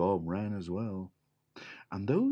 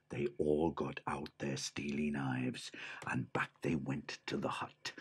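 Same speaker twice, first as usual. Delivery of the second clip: soft whisper; whispered narration; 6 semitones lower, 8 dB quieter than usual. A narrator's reading. Teller sneaking the bandits up, in a whisper.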